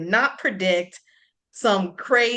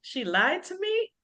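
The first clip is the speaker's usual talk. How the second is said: mock betrayed; 10 semitones higher, 5 dB quieter, slower than usual. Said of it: "I was like".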